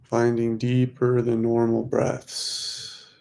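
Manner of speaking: normal talking voice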